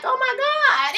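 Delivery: high, disbelieving oh my God; shocked gasp. Retold or imitated. Vlogger reacting to the rude date question, mock shocked. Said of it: "I was like".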